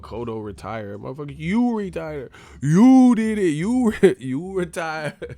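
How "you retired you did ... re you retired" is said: "you" hit high and loud; mock exasperated emphasis. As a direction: rise to a squealing protest on each "you", breaking into laughter. Comic protest at third-person talk.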